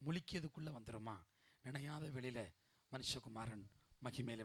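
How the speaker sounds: low, soft sermon voice; hushed preaching. Acted. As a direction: drop right down and confide it to the congregation.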